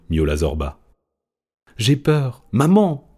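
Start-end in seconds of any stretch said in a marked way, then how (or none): 1.8-3.1 high, louder scared cry to her mother; a young gull calling out in fear; frightened cry. A narrator's reading. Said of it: "cria Fortunada".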